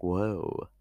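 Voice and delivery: drawn-out scandalised whoa; mock shocked whoa. Reading the tweet, a mock shocked whoa. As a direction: react to the dirty meaning. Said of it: "I was like".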